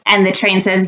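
ordinary delivery, nothing marked

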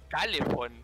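"calefón" con el golpe en la primera sílaba; acento chileno imitado. Retold or imitated. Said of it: "¿Qué es ese acento de mierda". ("calefón" remedado a la chilena, acento al inicio)

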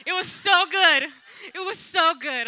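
loud, laughing and crying at once; shouting through tears and laughter; overcome shout. Real, not acted. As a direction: cry it out, overwhelmed with joy. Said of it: "laugh cry".

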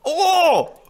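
thrilled shout. Real, not acted. New character reveal.

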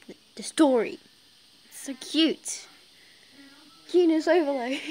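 loud, high gush of cuteness. Real, not acted. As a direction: gush over something adorable. Gushing over the story.

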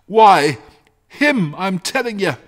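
loud, high, insistent outburst; agitated shepherd. Acted. Shepherd answering his wife about the creature, agitated. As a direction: burst out with exasperated insistence.

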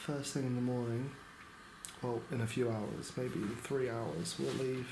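quiet weary update at night; hushed late-night talk. Real, not acted. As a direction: keep it down, people sleeping.

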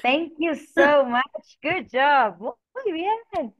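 high, loud, drawn-out congratulations; gushing teacher praise; delighted praise. Real, not acted. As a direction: cheer the student on, stretching the words. Teacher praising student who spelled correctly.

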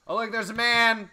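loud, high mock alarm; excited alarm. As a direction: call it out like a startled guard.

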